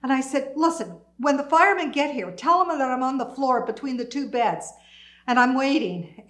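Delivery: urgent insistence. Retold or imitated. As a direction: press the instructions home. Insisting to phone operator during fire.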